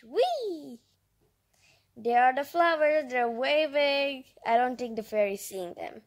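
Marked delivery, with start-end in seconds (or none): none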